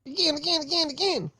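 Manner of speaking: high squeaky chant; high mock begging.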